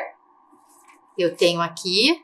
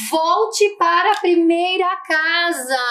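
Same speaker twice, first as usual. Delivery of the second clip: slow, higher, drawn-out reading; reading aloud; 8 semitones higher, slower than usual. Acted. Teacher reads the game square to pupils, slow read-aloud. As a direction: read the card out slowly and high. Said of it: "O que será que está escrito?".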